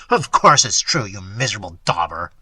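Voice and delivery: loud start, dropping onto the insult; mildly bitter, scornful retort; bitter contempt. A narrator's reading. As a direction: sneer the confirmation at him. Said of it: "I laughed bitterly".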